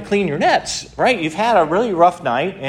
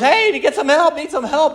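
high, urgent call to the other boats; high excited call for help; 11 semitones higher than usual. Retold or imitated. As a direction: shout across the water for help.

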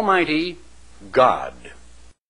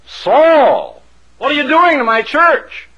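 loud, high, booming summons; heavenly voice; 9 semitones higher, 5 dB louder than usual. Acted. Heavenly voice confronting Saul about the church, booming rebuke. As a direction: call the name long, then demand an answer. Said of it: "A heavenly voice called his name".

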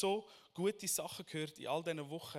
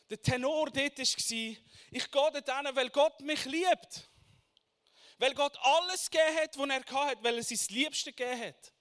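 much louder, higher, slowed preaching; 8 semitones higher, 9 dB louder than usual. Acted. Preacher recalling the church's core message, full-force preaching.

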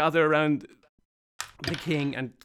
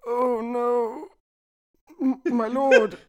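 lamenting servant; 7 semitones higher than usual. Acted. Sheepish servant laments king.

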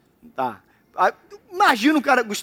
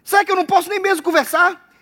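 indignant little brother; 8 semitones higher than usual. Acted. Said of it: "Aí o Davi diz".

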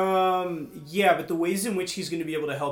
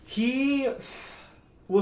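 ordinary talk

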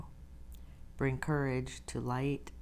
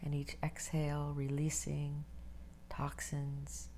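guided meditation; 4 dB quieter than usual. Acted. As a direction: ease them into stillness, breathy and unhurried.

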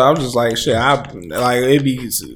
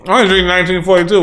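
fast, higher show-off brag; mocking snobby boast; 6 semitones higher, much faster than usual. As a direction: toss it off like a snob. Repeats the tequila boast.

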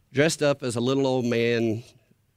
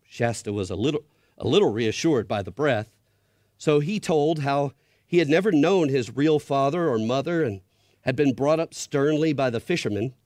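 reading a tense story aloud; story narration; 4 semitones higher, 4 dB louder than usual. A narrator's reading. Pastor reading Narnia to the church, hushed storytelling. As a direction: build suspense as you read to kids.

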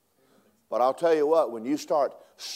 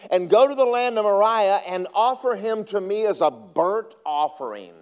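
booming preacher cadence, stretched out; loud pulpit reading; fiery scripture reading; 7 semitones higher, 8 dB louder than usual. Acted. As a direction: proclaim the verses to the congregation. Preacher reading scripture at full force.

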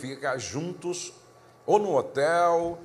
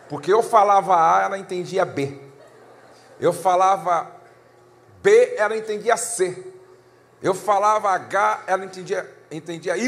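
louder, higher, building frustration; escalating argument; 6 semitones higher, 7 dB louder than usual. Retold or imitated. Pastor telling the congregation about a fight with his wife, raised and exasperated. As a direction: pile it on, each line more heated. Retelling a heated marital misunderstanding.

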